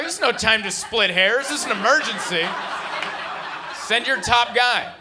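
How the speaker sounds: urgent demand. Retold or imitated. Acted urgent call to exterminator.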